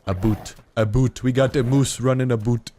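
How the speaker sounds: put-on Canadian accent, punching "boot"; bad mock Canadian accent. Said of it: "Stereotypical Canadian accent".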